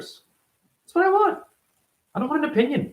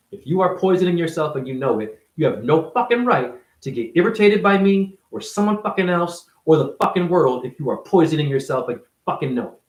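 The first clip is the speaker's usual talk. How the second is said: ordinary delivery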